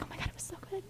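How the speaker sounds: hushed, voiceless fanboy gushing; whispery mock excitement; mock freaking out. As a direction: imitate someone gushing under the breath. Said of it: "he's, like, freaking out".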